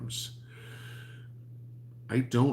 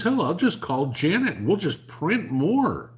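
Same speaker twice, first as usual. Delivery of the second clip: mock Joe; 10 semitones higher, 7 dB louder than usual. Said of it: "He goes".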